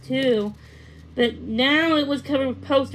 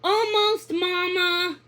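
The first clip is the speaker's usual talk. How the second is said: calling out; 7 semitones higher, slower than usual. A narrator's reading. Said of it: "Pippa called out".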